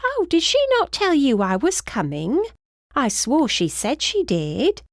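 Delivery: high, swooping British surprise; chatty British woman, taken aback; flustered British housekeeper. A narrator's reading. Housekeeper learns nobody announced her coming, surprised British voice.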